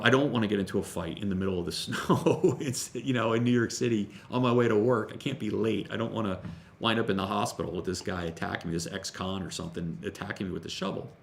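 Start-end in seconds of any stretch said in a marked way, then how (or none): none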